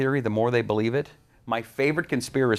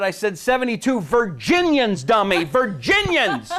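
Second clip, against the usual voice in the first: high, loud, slow divine scolding; booming punchline voice; 9 semitones higher, 5 dB louder, slower than usual. Retold or imitated. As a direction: deliver the punchline with relish. Punchline voice of God.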